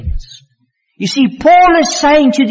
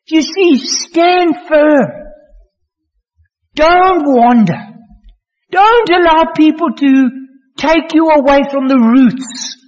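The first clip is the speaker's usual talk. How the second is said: high, slow, near-crying pleas building to a shout; impassioned preaching; 6 semitones higher, slower than usual. Acted. Preacher pleading with the congregation not to wander, impassioned. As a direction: plead with them to hold fast.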